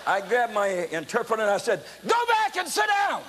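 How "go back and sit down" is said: high, loud, slow order; commanding shout. Retold or imitated. Preacher ordering the interpreter to sit down, shouted. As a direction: bark the order with full authority.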